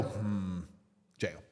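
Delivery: low, soft deliberating hum, then the sentence; quiet mock-judicial pronouncement; imitated judge verdict. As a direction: mimic a court pondering briefly. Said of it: "sit there and go".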